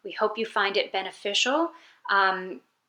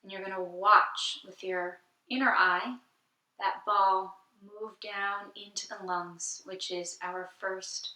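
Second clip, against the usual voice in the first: soft, unhurried meditation voice with long pauses; guided meditation; 7 dB quieter than usual. Acted.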